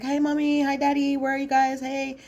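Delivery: high, sing-song greeting; calling out sweetly. Retold or imitated. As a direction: call into the house cheerfully. Aisha calling out to parents.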